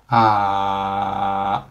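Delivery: "ah" dragged long at the lowest pitch; third tone, bottom pitch. Shown as a demonstration. Teacher shows the bottom of the third tone, "ah" long and low. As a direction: sink "ah" to the floor of your voice and hold. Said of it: "drag our voice down".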